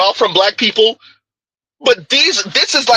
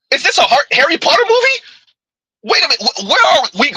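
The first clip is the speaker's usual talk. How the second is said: much higher, disbelieving question; can't believe what he just heard; incredulous; 7 semitones higher, faster than usual. Real, not acted. Host reacting to a clip on stream, incredulous and high.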